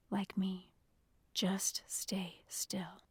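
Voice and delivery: low, slowing whisper; hushed instruction. A narrator's reading. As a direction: whisper it to keep someone still. Jeremy whispers while hiding.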